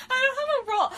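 high, loud mocking squeak; mock whiny girl. Reader mocking Hillary's line in a high squeak. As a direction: parody the whiny request. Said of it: "mocking Hillary's line".

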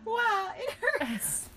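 high, drawn-out pitiful whine; hammed-up hurt character voice; mock pain. A narrator's reading. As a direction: wail slowly over a sore hand. Burned character complains, acted hurt.

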